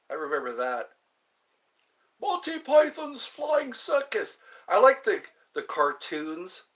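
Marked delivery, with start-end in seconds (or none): 2.2-4.4 mock announcer.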